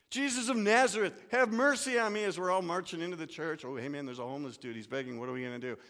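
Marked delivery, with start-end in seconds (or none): 0.1-2.2 high, loud plea for mercy; calling out. Pretend. Beggar at the church door calling out loudly for mercy. 3.7-5.8 fast, softer casual chatter; casual aside. Pretend. Churchgoer to friend about the beggar, quick and hushed.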